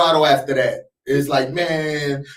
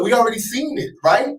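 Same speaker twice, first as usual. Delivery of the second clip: normal talking voice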